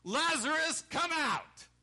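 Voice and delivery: very high, very loud drawn-out call; huge commanding holler; full shout. Acted. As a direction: shout to raise the dead. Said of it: "And Jesus shouted".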